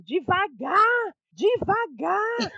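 high, drawn-out calling voice; mock yell. Imitating her brother yelling at the driver, high drawn-out mock yell. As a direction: stretch each word out high, like calling ahead. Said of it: "Aí o meu irmão gritando".

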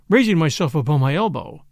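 usual speaking voice

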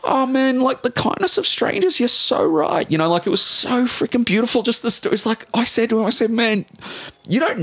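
very high, overwhelmed with real emotion; be moved almost to tears, pitch way up; getting teary. Real, not acted. Agreeing with host about the kindness of strangers, teary. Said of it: "I'm getting teary just thinking about this".

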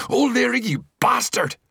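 furious high scream. A narrator's reading. Maguire screaming at the informer O'Leary. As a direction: scream the betrayal at full pitch. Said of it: "screamed Maguire".

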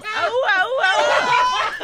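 loud, high whooping; hammed-up high dockside hollering; mock crowd yell. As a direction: whoop loudly like cheering onlookers. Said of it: "people who see him go by and yell".

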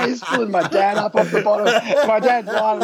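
high laugh spilling into the words; real laughter, barely getting the line out; laughing while telling. Real, not acted. Telling mates the funny dive, laughing hard and high. Laughing at his own story.

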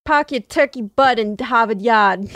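put-on Boston accent with dropped r's. Massachusetts local telling off a turkey, in a Boston accent. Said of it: "That's a pretty good accent".

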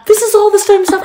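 high, loud, laughing disbelief; incredulous gushing. Retold or imitated. Telling Jerry he is mirroring her, high laughing disbelief. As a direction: squeal it through laughter, retelling your own amazement.

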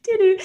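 high chirpy imitation of a GPS beep; imitated beep. Co-host imitating a phone's navigation beep, high.

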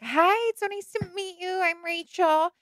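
imitating a sweet, shy girl meeting the family; sweet little Rachel. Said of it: "sweet little Rachel".

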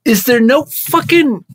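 mock outrage. Boss's mock-outraged plea.

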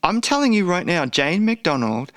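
normal talking voice

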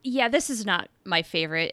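normal talking voice

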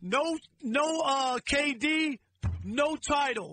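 slow, drawn-out punch on "no title"; desk-banging emphasis. Retold or imitated. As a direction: hammer each word of "K D, no title" slowly. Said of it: "I banged on this desk".

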